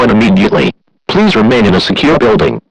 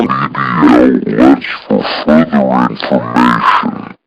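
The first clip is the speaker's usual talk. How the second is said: much lower and slowed, warping audio; distorted slowed broadcast; 11 semitones lower, slower than usual. Acted. Hijacked alert warping before the villain speaks, dragging low. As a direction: let the tape drag down into a groan.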